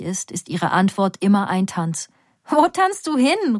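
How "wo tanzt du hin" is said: calling out. A narrator's reading. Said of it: "rufe ich ihr zu".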